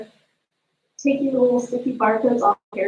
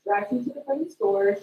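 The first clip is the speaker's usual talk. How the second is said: ordinary talk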